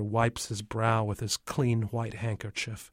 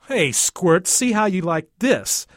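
put-on high, loud kid's challenge; playful taunt; 7 semitones higher, 6 dB louder than usual. Splashing Tommy back in the river, taunting playfully. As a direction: holler it teasingly.

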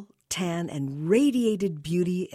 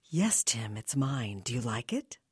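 sultry whisper; 5 semitones lower, 5 dB quieter than usual. Acted. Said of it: "seductive shopper".

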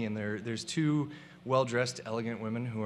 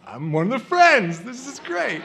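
excited; 9 semitones higher, 9 dB louder than usual. Retold or imitated. Excited about a shared driver.

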